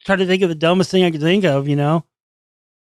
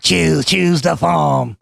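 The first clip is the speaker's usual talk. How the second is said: deep god voice; 3 semitones lower, much slower than usual. Retold or imitated. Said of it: "was like".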